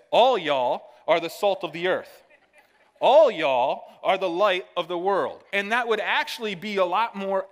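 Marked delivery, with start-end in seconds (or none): 0.1-5.4 louder, a mock Southern reading of the verse; Southern lingo. Shown as a demonstration. Pastor reading scripture to the congregation in mock Southern. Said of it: "those verses would read more like this".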